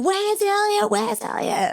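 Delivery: very high, sustained mimed shout; retold calling out. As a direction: call across water on one high held note. Retold calling to rescuers about missing friend.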